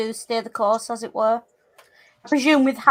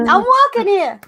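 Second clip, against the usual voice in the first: high, loud hammed-up New York cry; New York accent; 8 semitones higher, 6 dB louder than usual. Player joining in on the cliché joke, loud mock New York accent. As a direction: blurt it like a cartoon New Yorker. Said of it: "I can't do a New York accent".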